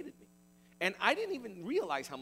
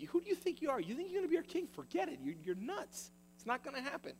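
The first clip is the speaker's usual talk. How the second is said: scornful brothers; 8 semitones higher than usual. Acted. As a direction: taunt him like a jeering older brother. Brothers mocking Joseph's dream.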